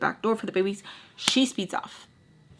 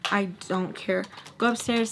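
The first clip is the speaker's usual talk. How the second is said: usual speaking voice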